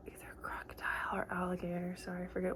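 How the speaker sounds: hushed, breathy whisper of the killer's identity; quick whispered aside. Real, not acted. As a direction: lean in and whisper it like a secret. Conspiratorial aside about the killer.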